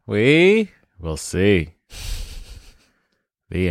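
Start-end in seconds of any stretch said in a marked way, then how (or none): 0.1-1.7 We will see, every word drawn out slowly; slow emphatic. Acted.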